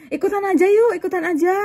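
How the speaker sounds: high, lively invitation; eager coaxing, retold. She invites an older woman to join dinner, retold eager coaxing.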